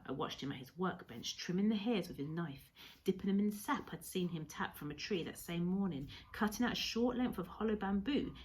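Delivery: ordinary delivery, nothing marked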